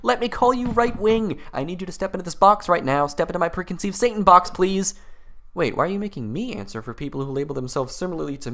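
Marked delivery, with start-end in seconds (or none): 0.0-4.9 mocking caricature of critics. Mocking his critics' accusations.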